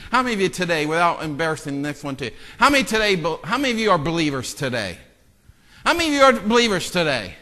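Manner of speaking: usual speaking voice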